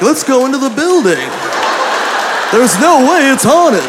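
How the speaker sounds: high-pitched, naive cartoon bravado; hammed-up eager mystery solver; mock Scooby-Doo gang. Mocking the cartoon gang entering a haunted building, high and eager. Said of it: "I'm not gonna be like".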